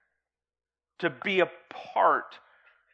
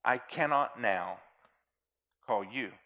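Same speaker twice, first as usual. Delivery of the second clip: poem recitation; 3 dB louder than usual. Acted. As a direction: recite it gravely, line by line.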